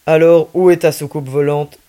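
put-on a policeman teasing a child about his spaceship, fatherly. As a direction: humor the kid like a kindly dad. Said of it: "avec une expression très paternelle".

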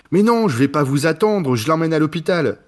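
lightly voiced stiff angry actor; a hint of wooden annoyance; bad acting. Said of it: "quand il s'énerve, il fait". (colère mal jouée, voix un peu plus aiguë)

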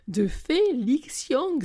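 high, slow, drawn-out exclamation; excited cry. A narrator's reading. Best friend cries out the star's name over the phone, excited. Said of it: "s'écrie-t-elle".

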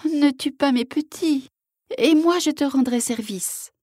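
very high, imploring voice; pleading mother wolf. A narrator's reading. Mother wolf implores hunter to spare cubs, high and pleading. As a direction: beg for mercy in a high voice. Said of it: "une louve le regardait d'un air suppliant".

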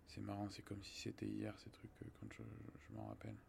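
murmure intime de souvenir; chuchoté. Real, not acted. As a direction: presque chuchoté, tout bas. Souvenir confié à voix basse. (à voix chuchotée)

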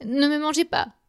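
supplication aiguë répétée; suppliant. A narrator's reading. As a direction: implorer encore, voix haute. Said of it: "supplie-t-il au géant". (supplique apeurée)

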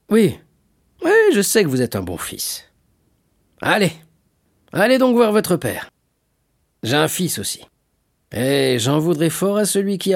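high, animated fatherly urging; hearty shipowner, fatherly. Acted. Shipowner sends a good son to his father, hearty. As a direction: jovially push him to go home.

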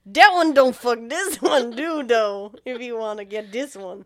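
a hint of a loud, hammed-up slave trader; lightly voiced trader making a pitch; mock slave trader. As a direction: ham up a shady salesman hawking people. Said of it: "and he's like".

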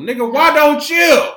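high, loud comeback. Real, not acted. Answering people mocking her mask, high and loud. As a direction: fire it back, high and loud. Snapping back at mask critics.

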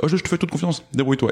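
voix haute et légère, chef qui se défausse; manager désinvolte. Retold or imitated. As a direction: imitez un manager insouciant qui lâche son équipe. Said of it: "qui vont te dire". (manager qui dit à son équipe de se débrouiller, ton désinvolte)

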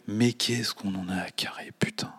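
hushed contemptuous aside; scornful whisper. Acted. Host mocking the objects drill, whispered. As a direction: whisper it like a swear you shouldn't say.

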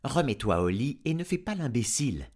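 a touch of bossy scolding; scolding wife. A narrator's reading. Wife ordering farmer back to bed, lightly scolding.

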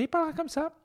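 mock squeaky voice; squeaky new voice. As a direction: suddenly talk way up high. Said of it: "il va, et après".

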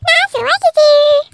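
loud high crab voice. Acted. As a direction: shout back like a cheeky crab. Water fight, crab answers.

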